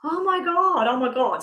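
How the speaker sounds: high, delighted "oh my God" twice; imitating excitement. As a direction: play the thrilled client hearing the news. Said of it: "This is you going".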